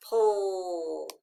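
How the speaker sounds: "pull" drawn out, falling; long "pull" sinking in pitch; isolated final syllable. Shown as a demonstration. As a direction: stretch "pull" as one lone syllable. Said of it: "P L E says pull".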